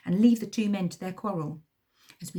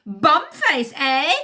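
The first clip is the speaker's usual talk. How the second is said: put-on high loud snarl in accent; first henchman snarling, heavy accent; 8 semitones higher, 11 dB louder, much slower than usual. A narrator's reading. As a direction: snarl it back menacingly. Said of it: "snarls the first man".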